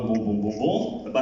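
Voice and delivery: ordinary delivery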